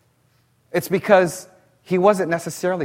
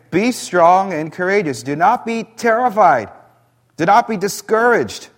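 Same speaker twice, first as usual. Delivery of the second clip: higher and louder, rousing command; emphatic pep talk; 5 semitones higher, 5 dB louder than usual. Acted. God giving Joshua a pep talk, higher and louder. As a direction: fire him up like a coach. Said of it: "He says again".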